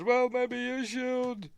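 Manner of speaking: high, drawn-out mock scream; retold scream. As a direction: stretch it into a high-pitched retort. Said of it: "Starts screaming at him".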